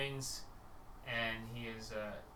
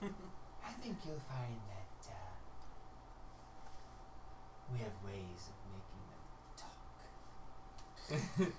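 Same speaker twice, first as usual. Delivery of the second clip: sinister quiet; 5 semitones lower, 9 dB quieter than usual. Acted. Tia to the party about making prisoners talk, soft menace.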